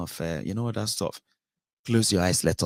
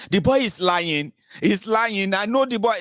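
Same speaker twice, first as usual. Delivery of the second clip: high, pressing voice of the accused big man; mock Ekweremadu insisting in court the boy lies, high pleading; pleading insistence; 11 semitones higher, 4 dB louder than usual. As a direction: swear he knows the boy, voice climbing high.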